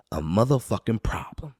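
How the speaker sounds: usual speaking voice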